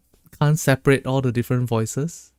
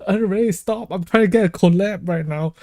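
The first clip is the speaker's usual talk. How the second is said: playful protest; 6 semitones higher than usual. Real, not acted. Teasing friend cuts in.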